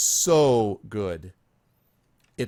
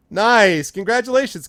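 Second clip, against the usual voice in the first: excited cheer; 8 semitones higher, 8 dB louder than usual. Real, not acted. Cheering a viewer's news in chat.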